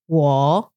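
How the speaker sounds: 我 pitched far below normal; low third tone. Shown as a demonstration. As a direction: drop 我 low and hold. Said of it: "lower than your normal pitch".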